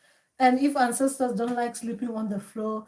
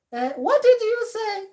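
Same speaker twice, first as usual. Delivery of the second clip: high, loud puzzled cry; confused; 12 semitones higher, 9 dB louder than usual. Pretend. Dreamer straining to hear ancestors, loud and confused. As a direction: call out as if you barely caught it.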